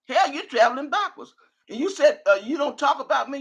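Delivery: ordinary delivery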